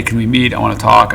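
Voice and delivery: ordinary delivery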